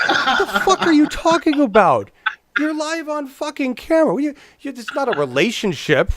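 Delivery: high, loud incredulous outburst; hammed-up exasperated disbelief; mock outrage. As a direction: react as if appalled on live camera. Acts out incredulous reaction to Cohen.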